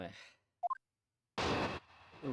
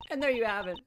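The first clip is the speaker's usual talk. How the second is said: put-on high-pitched triumphant girl voice; 9 semitones higher, 4 dB louder than usual. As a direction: crow it high and pleased. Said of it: "I forgot her voice was, like, high".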